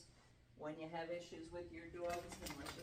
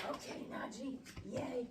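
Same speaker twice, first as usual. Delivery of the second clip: high, airy praise for the pup; cheery dog talk; 7 semitones higher than usual. Real, not acted.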